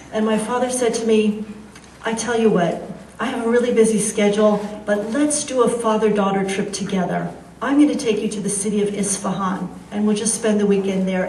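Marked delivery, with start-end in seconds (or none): none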